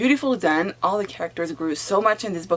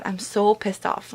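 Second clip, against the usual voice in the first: ordinary talk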